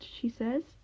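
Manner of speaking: ordinary delivery, nothing marked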